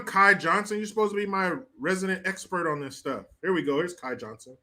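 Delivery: ordinary talk, nothing marked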